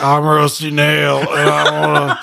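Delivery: slow, put-on killer trucker voice; mock truck driver menace, breaking into a laugh; menacing trucker voice. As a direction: growl it out like a creepy trucker. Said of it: "And he'd be like".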